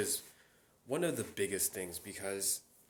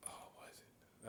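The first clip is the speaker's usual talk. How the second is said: whisper; 20 dB quieter than usual. Real, not acted. Searching memory aloud.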